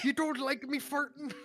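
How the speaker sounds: put-on old sailor voice. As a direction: squeal it like a salty old seaman. Dafoe impression, laughing.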